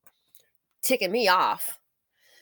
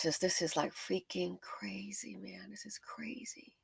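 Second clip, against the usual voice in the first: unvoiced, much softer, slow; stunned whisper; 15 dB quieter, slower than usual. Real, not acted. Vlogger whispering how crazy the situation is. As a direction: whisper it in disbelief.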